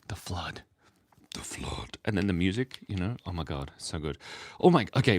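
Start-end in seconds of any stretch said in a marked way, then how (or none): 0.1-2.0 whisper. Real, not acted. Awed memory of Halo's flood.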